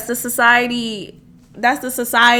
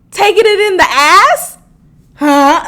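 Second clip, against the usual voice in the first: higher and louder indignant rise; outraged rising question; 7 semitones higher, 8 dB louder than usual. Real, not acted. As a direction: demand it, indignant. Disbelief at diet news.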